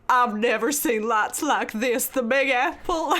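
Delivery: slow, drawling mock Southern; wide-eyed Southern country girl, drawn out; Southern accent. Country girl marvelling at Times Square, mock Southern accent. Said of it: "Where the Crawdads Sing Southern accent".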